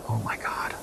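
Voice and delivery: hushed, frightened oh my God; stranded man's scared whisper; panicked whisper. Acted. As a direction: gasp it out under your breath in panic. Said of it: "he's in a panic".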